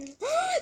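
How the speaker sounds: high sharp gasp of surprise. Real, not acted. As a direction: gasp as she feels something. Finds an extra squishy.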